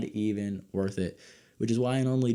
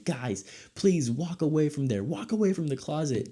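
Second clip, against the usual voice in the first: pleading at the TV; 8 semitones higher than usual. Retold or imitated. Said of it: "you're screaming at the television going".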